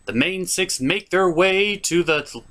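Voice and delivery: usual speaking voice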